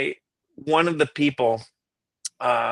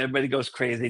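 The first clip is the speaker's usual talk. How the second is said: ordinary talk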